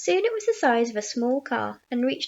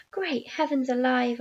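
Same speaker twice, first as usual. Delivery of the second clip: usual speaking voice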